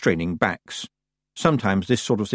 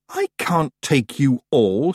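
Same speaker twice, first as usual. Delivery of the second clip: slow, raised objection; exasperated protest; 5 semitones higher, slower than usual. A narrator's reading. Tiffany objects to her stowaways, exasperated. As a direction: stretch it out in protest.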